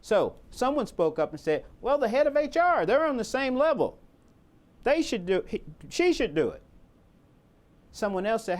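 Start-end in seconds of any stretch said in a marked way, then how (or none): 1.8-6.6 higher-pitched voice of a colleague piping up; eager suggestion. Retold or imitated. Team member nominates the head of HR, piping up. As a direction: pipe up, lightly imitating an eager team member. Acts someone volunteering the HR head.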